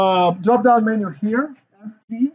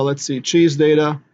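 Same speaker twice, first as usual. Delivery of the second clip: ordinary talk, nothing marked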